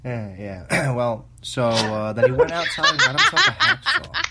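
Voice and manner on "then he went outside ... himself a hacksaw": high cackling laugh through words. Acted. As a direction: crack up mid-story, high and loud. Creepy laugh while telling story.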